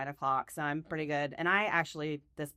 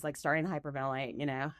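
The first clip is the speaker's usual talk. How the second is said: normal talking voice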